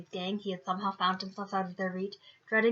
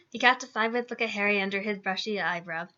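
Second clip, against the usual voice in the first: plain reading voice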